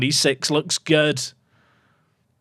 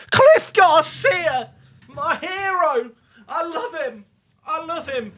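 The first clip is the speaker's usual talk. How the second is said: much higher, slow, swooning cry of adoration; gushing delight; 11 semitones higher, slower than usual. Real, not acted. Streamer celebrating his striker's wonder goal, gushing.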